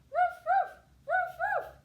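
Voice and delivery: high, loud repeated puppy woofs; quick pairs of sharp dog barks. A narrator's reading. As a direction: bark insistently like a little puppy. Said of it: "said her little puppy".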